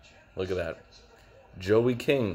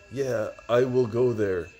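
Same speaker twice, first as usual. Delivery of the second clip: stilted mock voice; 4 semitones higher than usual.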